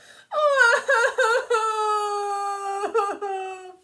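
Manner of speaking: high, loud drawn-out wail; a tree howling in misery, long and loud; wailing cry. A narrator's reading. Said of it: "wailed the tree".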